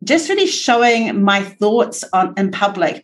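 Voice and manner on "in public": ordinary talk, nothing marked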